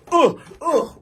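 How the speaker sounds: two high, loud effort grunts; punching grunts. Retold or imitated. Storyteller reenacts swinging back, loud high grunts. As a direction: imitate throwing two quick punches. Imitating his comeback punches.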